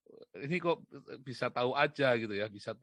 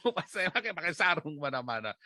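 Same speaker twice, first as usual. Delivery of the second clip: real laughing; 2 semitones higher, 3 dB louder than usual. Real, not acted. Speaker amused by opa in a sarong everywhere, laughing. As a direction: burst out laughing, then talk through it.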